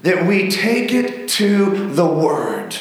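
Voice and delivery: very slow, each word weighted in "take it to the Word"; measured pulpit emphasis; slow emphatic phrase. Shown as a demonstration. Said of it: "It is so important".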